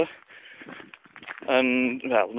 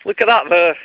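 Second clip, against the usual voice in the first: raised, louder mock outrage; fuming; 4 semitones higher, 5 dB louder than usual. Fuming customer pointing out the pie's contents, acted outrage. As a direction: insist crossly that he look. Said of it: "absolutely fuming".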